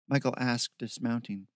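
ordinary delivery, nothing marked

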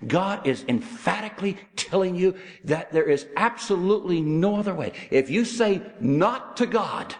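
high, forceful sermon delivery; emphatic preaching. Acted. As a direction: drive each phrase home to the congregation. Preacher drives the point home.

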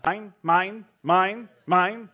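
high, loud squawking birds; mock seagull chant of mine; seagull cry. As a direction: call it out like greedy gulls. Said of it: "those hundreds of birds that kept going".

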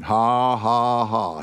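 slow, deliberate "ha, ha, ha"; Brother Hagin's forced laugh. Retold or imitated. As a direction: imitate a man making himself laugh. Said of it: "he just started laughing".

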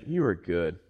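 low, hushed and tender; soft worship. Pretend. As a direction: murmur it like a quiet prayer. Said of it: "when you just say".

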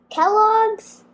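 ordinary delivery

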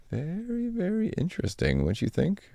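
high, soft, knowing very interesting; sly insinuating tone. Real, not acted. Repeats the phrase, sly remark.

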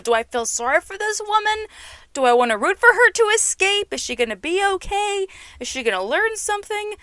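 high-pitched string of mock-doubtful questions; torn viewer questioning. Viewer unsure about rooting for the cruel woman, high doubtful questioning.

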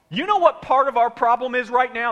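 loud, high, confrontational question; heated question. Shown as a demonstration. Preacher models arguing with spouse, loud and high. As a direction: demand it with heat.

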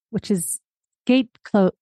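ordinary delivery

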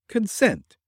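high-pitched woman's voice; a single word in a high, feminine voice; ghostly woman's voice. A narrator's reading. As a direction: voice of an unseen woman. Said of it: "a voice as of a woman".

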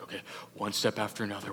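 nervous steps in the dark, kept soft; hushed and careful. Retold or imitated. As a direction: count the steps under your breath. Inching through the dark cave.

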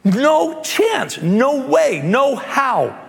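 high, emphatic refusal; indignant no chance no way; Peter protesting. Retold or imitated. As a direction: push back hard, voice climbing. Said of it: "And Peter's response was".

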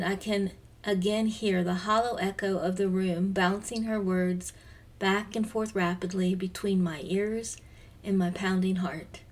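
ordinary talk

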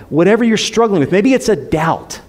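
usual speaking voice

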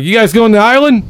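high, eager undercover cop; lightly voiced friendly cop asking. Cop asking travelers about the island, lightly voiced. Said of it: "he's like".

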